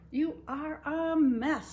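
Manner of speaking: drawn-out fond scolding; Amelia Bedelia fussing. Acted. Amelia scolds messy baby.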